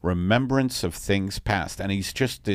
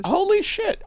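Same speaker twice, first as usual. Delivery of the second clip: surprised. Real, not acted. Hearing dog named after madeleines.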